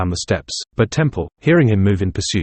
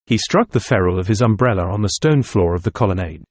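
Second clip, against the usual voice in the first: ordinary delivery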